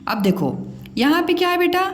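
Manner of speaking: normal talking voice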